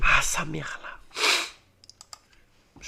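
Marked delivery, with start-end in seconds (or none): 0.0-0.9 breathy whispered swear under the breath; startled whispered oath; whispered curse. Real, not acted. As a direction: hiss the curse after a fright. Jump scare in horror game.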